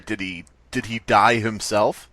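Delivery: ordinary talk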